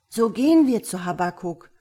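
loud, keen decision; eager child. A narrator's reading. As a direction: propose it with young enthusiasm. (eifrig wie ein Kind)

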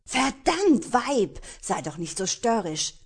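loud, high outburst after a kick; pained angry curse. Acted. Pirate, kicked in the shin, yells at Destiny loud and angry. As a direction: curse her out in pain.

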